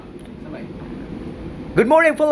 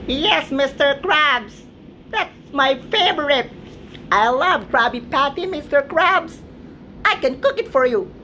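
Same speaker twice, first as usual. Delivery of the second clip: SpongeBob-style squeak; 2 semitones higher than usual. Pretend. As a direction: squeak it like an excited cartoon sponge. Eager cartoon reply to boss.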